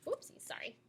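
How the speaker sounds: soft whispered apology; whispered aside. Real, not acted. Narrator apologizing softly, whispered. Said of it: "aside whisper".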